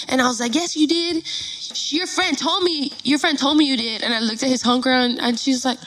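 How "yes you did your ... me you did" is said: higher-pitched retold argument; insistent accusation. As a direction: push back hard on the denial.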